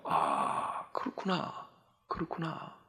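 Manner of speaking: soft breathy whisper of dawning understanding; whispered realization. Retold or imitated. Pastor recalling his private thought, whispered aside. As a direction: whisper it to yourself as it sinks in.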